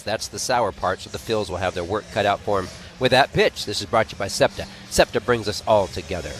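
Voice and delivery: ordinary delivery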